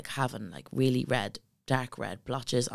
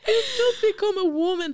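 much higher and louder, laughing through the words; laughing disbelief; 7 dB louder than usual. Real, not acted. Laughing at the absurd diagnosis.

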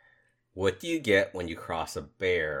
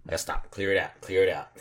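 ordinary delivery

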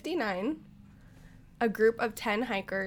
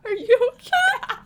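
laugh-crying; 6 dB louder, slower than usual. Real, not acted. Laughing fit during sad story.

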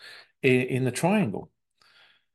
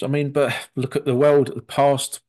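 normal talking voice

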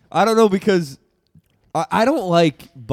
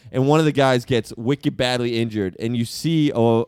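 normal talking voice

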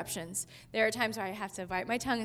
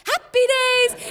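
excited cry; 12 semitones higher, 9 dB louder than usual. Retold or imitated. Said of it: "the kids were like".

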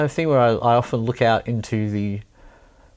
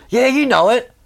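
high, loud mock heckle; shouting heckler; 13 semitones higher, 5 dB louder than usual. Punter pestering the musician to play a song, mock shouting. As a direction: imitate an insistent punter shouting requests. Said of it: "You shouting it at me".

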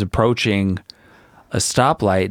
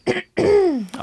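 throat clear. Real, not acted. Throat clearing before drinking.

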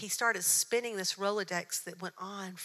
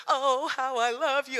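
a touch higher and louder, slow, crooning back; answering coo; 5 semitones higher, 7 dB louder than usual. Retold or imitated. Answering Jen in kind: mild slow crooning coo. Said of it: "And I went".

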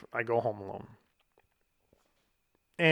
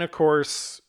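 plain reading voice

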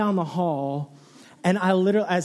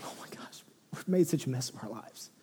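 hushed dismay; 3 semitones lower, 8 dB quieter, faster than usual. Retold or imitated. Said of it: "They're going".

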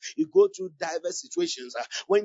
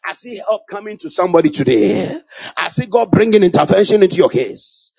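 preaching; 10 dB louder than usual. Real, not acted. Preaching at full force.

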